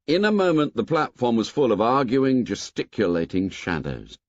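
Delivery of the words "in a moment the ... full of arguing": usual speaking voice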